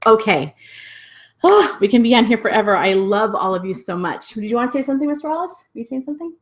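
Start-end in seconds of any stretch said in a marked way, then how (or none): 4.3-6.3 high, soft, cooing questions; coaxing little voice. Real, not acted. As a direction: coo softly to a little companion. Coaxing Mister Wallace to speak.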